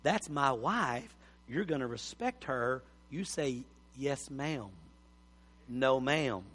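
calm dad setting the rule quietly; firm but gentle father. Retold or imitated. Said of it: "You need to go say".

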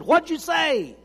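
high, loud asking; young man calling across to the preacher; loud question. Retold or imitated. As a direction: call out across the room. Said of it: "stepped across the room and said".

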